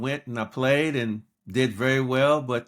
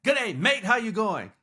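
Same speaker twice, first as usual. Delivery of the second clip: high-pitched cheery Aussie phone greeting; Australian caller; 7 semitones higher than usual. Retold or imitated. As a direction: imitate an Australian caller's breezy hello. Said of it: "and the voice goes".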